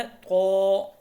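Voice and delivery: तो with heavy t and rounded held vowel; thick, lengthened. Shown as a demonstration. Teacher shows learners a heavy letter, thick तो held. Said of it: "तो मोटा लफ्ज़ है".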